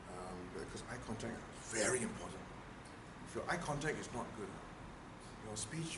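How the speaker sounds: soft, grave, deadly serious critique; serious criticism. Shown as a demonstration. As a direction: deliver it in all seriousness, quietly. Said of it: "if we say it as if it's serious".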